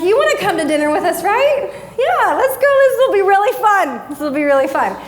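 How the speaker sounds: high, bubbly coaxing; hammed-up eager invitation. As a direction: talk it up excitedly, pitched high. Acting out eager invitation to kids.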